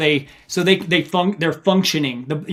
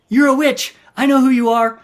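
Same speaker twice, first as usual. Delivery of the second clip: higher, louder frightened accusation; paranoid accusation; 7 semitones higher, 4 dB louder than usual. Retold or imitated. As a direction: accuse her like she's a witch. Hallucinating runner accusing wife.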